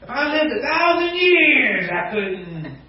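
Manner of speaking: milk the hopeless excuse; over-exaggerated excuse. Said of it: "we over-exaggerate".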